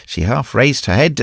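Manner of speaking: plain reading voice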